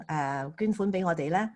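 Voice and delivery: normal talking voice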